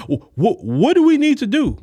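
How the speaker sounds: high, stammering, suddenly eager; mock flustered politician. Punished politicians asking voters what to do, flustered high stammer. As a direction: scramble to please.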